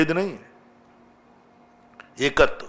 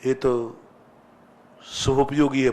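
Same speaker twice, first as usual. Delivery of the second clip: ordinary delivery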